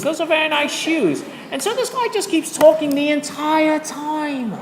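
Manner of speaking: high-pitched, hammed-up British accent; badly faked British, pitched well up; terrible British accent. Shown as a demonstration. Teacher describing the irrational guy on the train, high, terrible British accent. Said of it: "my terrible British accent".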